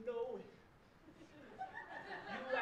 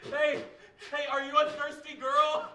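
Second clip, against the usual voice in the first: loud bar voice, calling out; shouting across bar; 6 semitones higher, 14 dB louder than usual. Acted. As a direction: yell it across a noisy bar.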